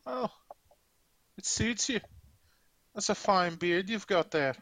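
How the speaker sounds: put-on horrible Scottish accent. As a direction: pay a compliment in mock Scottish. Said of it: "Competing with my horrible Scottish accent".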